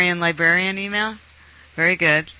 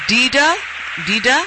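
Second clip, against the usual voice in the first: calling out; 8 semitones higher than usual. Real, not acted. Host checking whether the caller is on the line, calling out. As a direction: call out the name, pitched up and questioning.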